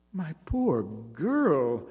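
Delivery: slow, pitying words; drawn-out pity for a poor girl. A narrator's reading. Simon pitying Jeanne's plight, slow and tender. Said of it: "the bareback rider said pityingly".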